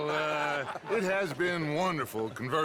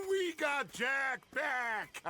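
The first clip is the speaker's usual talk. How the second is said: laughs, then a slow jubilant cry; excited laugh; 8 semitones higher, 3 dB quieter, much slower than usual. Acted. Leader cheering to his boys, jubilant laugh. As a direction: celebrate the win with a big laugh. Gang celebrates rescued boy.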